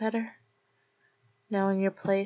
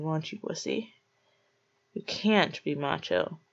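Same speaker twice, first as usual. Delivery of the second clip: normal talking voice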